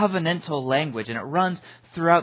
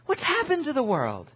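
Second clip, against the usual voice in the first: much higher, louder, wondering hobbit voice; Sam waking up; 10 semitones higher, 7 dB louder than usual. Retold or imitated. Pastor voicing Sam to Gandalf, high and amazed. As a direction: cry it out in amazed disbelief. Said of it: "And to this, Sam goes".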